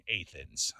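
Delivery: Athens said "AY-thens", slowly; local pronunciation. Shown as a demonstration. Host citing the local way of saying it, local pronunciation. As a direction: Athens with long A, drawn out. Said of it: "the way you say".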